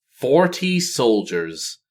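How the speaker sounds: usual speaking voice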